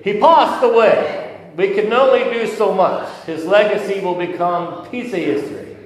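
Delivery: high, loud hammed-up Italian accent; leading the congregation in a hammed-up Italian accent. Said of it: "you have to do it in an Italian voice".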